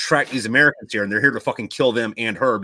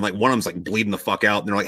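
usual speaking voice

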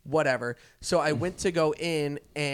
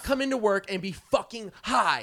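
higher, louder retold rant; indignant mock outburst, raised voice; so mad; 6 semitones higher, 7 dB louder than usual. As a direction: imitate a furious coworker complaining. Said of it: "she was so mad".

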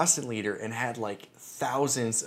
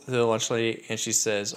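plain reading voice

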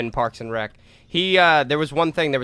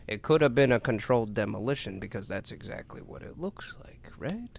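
conspiratorial whisper; 4 semitones lower, 8 dB quieter than usual. Pretend. Conspiratorial aside.

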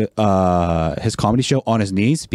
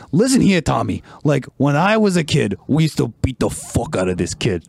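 imitating a rough-talking Cuban comic; lightly put-on rough, profane storyteller voice; Joey Diaz; 3 semitones higher than usual. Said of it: "he just talks so rough".

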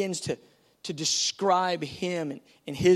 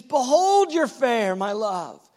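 bursting, much higher and louder exclamations; overjoyed Jesus; 5 semitones higher, 7 dB louder, slower than usual. Acted. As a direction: gush over her beauty. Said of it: "he's just blowing up with this".

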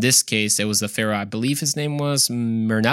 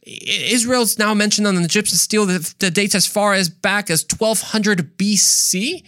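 imitating amazed scholars; 8 semitones higher than usual. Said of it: "They're like".

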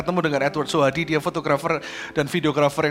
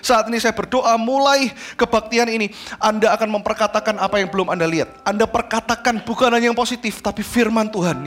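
higher, driving sermon voice; emphatic, raised-pitch exhortation; impassioned preaching; 6 semitones higher than usual. Real, not acted. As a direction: preach it with mounting conviction.

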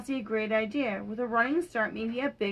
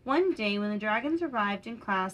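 ordinary delivery

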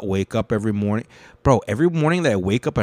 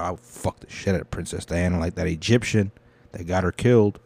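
usual speaking voice

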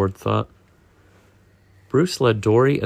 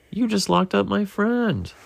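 narrated shout; 11 semitones higher than usual. A narrator's reading. Nemo confronts the fish who trapped his friend, shouting mildly. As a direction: protest in a high, restrained shout. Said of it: "shouted Nemo".

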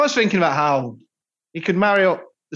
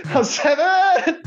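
laughing punchline; 12 semitones higher, much slower than usual. Real, not acted.

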